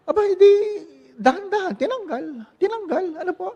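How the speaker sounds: high, loud, laughing-crying yelps of pain; mock pain. Patient cries out as the doctor pulls off the bandage, high laughing-crying. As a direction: relive the sting half laughing, half crying. Reliving the painful removal.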